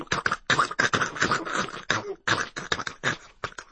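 quick, voiceless clicking of hooves; soft rapid clip-clop imitation; imitated hoofbeats. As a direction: click out a galloping horse. Imitates a galloping horse's hooves.

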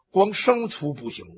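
ordinary delivery

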